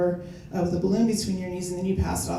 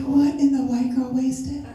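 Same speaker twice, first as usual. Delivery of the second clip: shocked disbelief; 5 semitones higher, 4 dB louder than usual. Retold or imitated. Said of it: "I was like".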